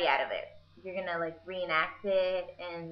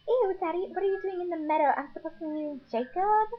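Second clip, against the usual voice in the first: much higher, louder and fast; grossed-out teen Nessie; 9 semitones higher, 5 dB louder, faster than usual. Retold or imitated. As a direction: whine it, disgusted with dad.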